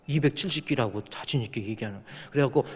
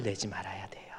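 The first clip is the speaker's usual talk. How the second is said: whisper; 6 semitones lower, 10 dB quieter than usual. Real, not acted. Pastor lowers voice, aside.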